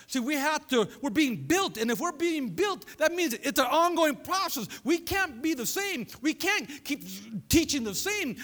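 insistent, building preacher's voice; emphatic preaching. Real, not acted. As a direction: drive each point home to the congregation. Preaches with rising intensity.

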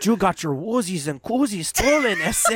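slow put-on Mexican accent. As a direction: exaggerate the Mexican accent, slowly. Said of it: "my Mexican voice on".

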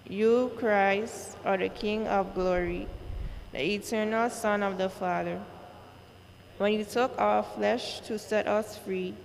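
ordinary talk

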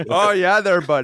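put-on high, bright Canadian accent; hammed-up Canadian bud voice. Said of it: "you keep it real Canadian".

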